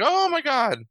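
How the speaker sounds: high, loud mock gasp; shocked gasp. Wife hears husband is dead.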